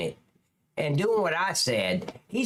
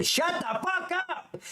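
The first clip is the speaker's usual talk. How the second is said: mock scream; 12 semitones higher than usual. Reactor shows how they'd silence the chaotic scene, mock screaming. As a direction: act out screaming for silence, high and slow. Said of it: "I'd be screaming".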